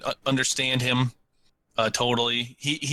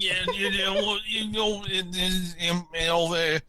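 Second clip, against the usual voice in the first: higher, lightly imitated rambling; rambling stranger; 5 semitones higher than usual. Homeless man rambling at the friends, lightly mimicked and higher. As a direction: loosely mimic a vague, trailing stranger. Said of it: "And he was like".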